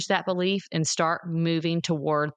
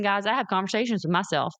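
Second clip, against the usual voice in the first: normal talking voice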